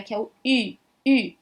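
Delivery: "U" lips pursed as French ü; French u sound. Shown as a demonstration. Teacher recalls pursed "U" of the alphabet. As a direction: pout lips for "U". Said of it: "ela tem o som do biquinho".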